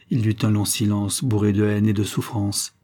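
ordinary delivery

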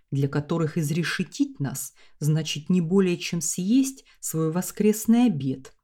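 plain reading voice

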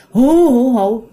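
loud drawn-out mock wail hú; imitated ghost wailing. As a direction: wail like a ghost crying through the night. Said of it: "nó vô cái khóc tiếp". (giả tiếng ma khóc hú vang)

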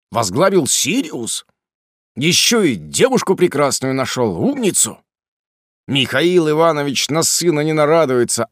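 high, loud scolding parent voice; nagging parent. Acted. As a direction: scold him like a fed-up mother.

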